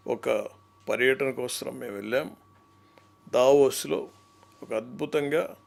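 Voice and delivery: low, measured politician's press statement; Chandrababu Naidu impression. Mimic doing Chandrababu Naidu's voice on Davos. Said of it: "Chandrababu Naidu గారు ఇప్పుడు మాట్లాడితే ఎలా ఉంటుందంటే".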